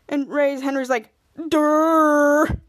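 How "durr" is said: loud, drawn-out mock-dumb durr. Henry mocks what is obvious, drawn-out durr.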